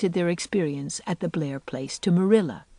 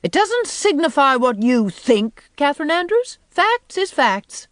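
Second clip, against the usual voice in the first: contemptuous; 8 semitones higher, 5 dB louder than usual. A narrator's reading. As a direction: lay down the law with disdain. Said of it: "Miss Eliza's voice expressed the utmost contempt".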